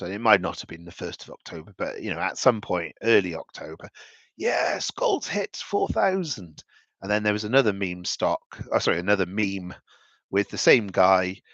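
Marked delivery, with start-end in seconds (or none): 4.4-6.6 high-pitched, drawn-out celebration; mock cheering. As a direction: imitate a jubilant investor, pitched way up. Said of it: "the guy cheering that price".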